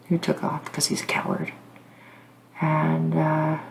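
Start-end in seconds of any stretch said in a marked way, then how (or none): 0.1-1.5 a hushed, bitter aside; contemptuous whisper. Real, not acted. About the husband who fled, whispered with scorn.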